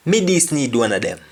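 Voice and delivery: quick patois, Me stressed; fast patois Me this need; Jamaican patois. Shown as a demonstration. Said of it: "I just need one of them".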